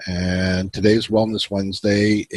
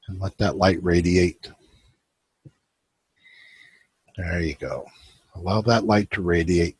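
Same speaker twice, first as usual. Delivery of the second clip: guided meditation. Acted. Host guiding his viewers into relaxation, calm guided meditation. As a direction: ease the listeners into stillness.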